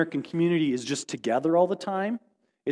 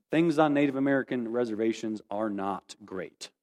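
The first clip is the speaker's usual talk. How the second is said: solemn, deliberate repetition; 7 semitones lower, 4 dB quieter than usual. Real, not acted. Speaker telling listeners about life on reservations, solemn and deliberate. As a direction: let it sink in, measured and low. Repeats the point for solemn emphasis.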